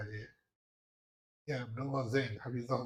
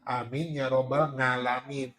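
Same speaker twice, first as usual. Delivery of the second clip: put-on Javanese accent; 4 dB louder, slower than usual. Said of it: "mengucapkan A dengan apa?".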